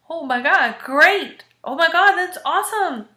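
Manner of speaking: high-pitched, thrilled congratulations; excited cheer for a friend; retold delight. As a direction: gush over the good news, pitch swooping up. Retold delighted reaction to job news.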